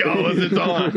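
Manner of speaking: high-pitched imitation of a fight challenge; hammed-up challenge; mock challenge. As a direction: act squaring up for a fight. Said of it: "And you were like".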